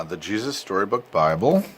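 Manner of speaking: ordinary delivery, nothing marked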